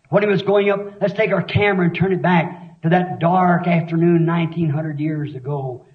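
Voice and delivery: low, hushed, picturing the road to the cross; hushed sermon storytelling. Acted. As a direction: draw them in close to the scene. Hushed vivid scene painting.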